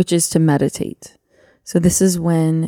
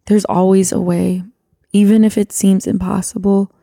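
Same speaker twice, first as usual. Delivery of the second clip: earnest spoken prayer, building louder as it pleads; heartfelt prayer; 2 semitones higher, 3 dB louder than usual. Acted. Wife prays for the listeners, earnest building prayer. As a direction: pray aloud with growing urgency.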